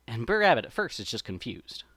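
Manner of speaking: ordinary delivery, nothing marked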